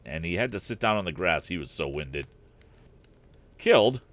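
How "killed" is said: a puzzled, louder echo of the word. A narrator's reading. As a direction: repeat it back in disbelief. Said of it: "clearly much puzzled".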